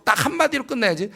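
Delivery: normal talking voice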